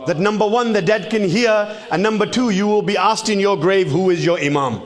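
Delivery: high, loud sermon delivery; forceful preaching. Acted. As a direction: drive the point home loudly. Preacher continues at full force.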